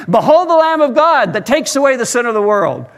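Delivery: high, loud heavenly proclamation; booming call heard from the street; voice crying out. Retold or imitated. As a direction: cry it out like a voice from nowhere. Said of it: "And again it came".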